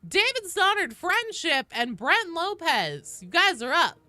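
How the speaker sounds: high, loud roll call; calling out names. Real, not acted. Streamer calls the next players in the queue, loud announcing.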